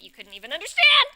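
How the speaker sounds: builds to a huge high yell; exasperated outburst. Acted. Friend scolds the romanticist, bursting into a shout. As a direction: explode on the last word. Exasperated climax of scripted argument.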